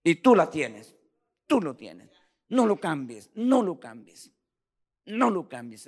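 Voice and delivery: loud, insistent sermon climax; loud impassioned pleading sermon; full-force preaching. Real, not acted. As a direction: drive it home to the congregation.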